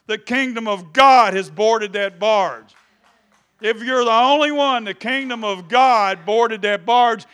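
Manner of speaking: loud, high, fiery preaching; shouted sermon climax; preaching at full force. Acted. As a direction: raise the roof for the congregation.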